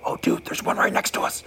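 hushed whisper, bull close by. Retold or imitated. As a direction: breathe it so the elk won't hear. Buddy hushed beside the bull.

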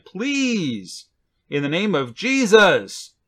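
high pleading please, slow drawn-out rest; begging plea. Retold or imitated. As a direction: implore them earnestly. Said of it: "he's begging".

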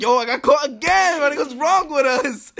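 high-pitched, incredulous complaint; worked-up storyteller's disbelief; retold exasperation. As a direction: replay the dismay, pitched well up. Retold exasperation at being caught.

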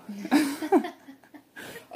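bursting laugh, high and loud; a real laugh at herself. Real, not acted. Laughing at her own intro.

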